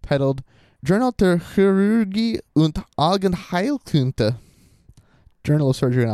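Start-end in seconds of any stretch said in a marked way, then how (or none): 0.8-4.3 slow, higher-pitched careful German; attempted German pronunciation. Shown as a demonstration. Host trying a German journal title for listeners, effortful German accent. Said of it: "I try, and I'm sorry, guys".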